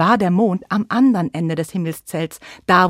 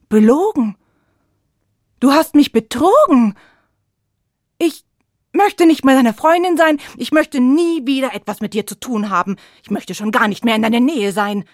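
loud, higher furious outburst; angry; 4 semitones higher, 7 dB louder than usual. Acted. Sun furious at moon for lying, shouting. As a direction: lash out at the betrayer.

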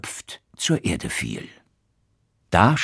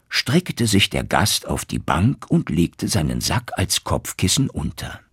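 ordinary delivery

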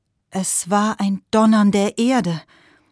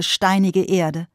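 ordinary delivery

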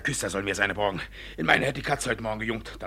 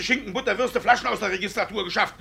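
ordinary talk, nothing marked

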